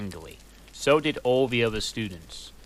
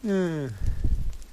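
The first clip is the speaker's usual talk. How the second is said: short laugh; 6 semitones higher than usual. Real, not acted. Laughing at the text.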